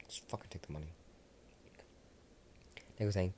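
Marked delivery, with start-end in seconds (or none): 0.1-0.9 whispered grumble. Acted. Discord mutters.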